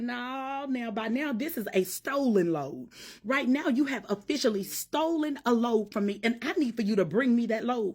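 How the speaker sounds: high, loud preaching climb as God speaks; preaching at full force. Acted. Preacher voicing God to her congregation, full-force preaching. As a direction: drive it home to the church, full preacher fire.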